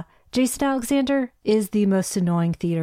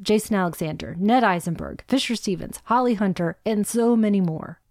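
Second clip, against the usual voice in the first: ordinary talk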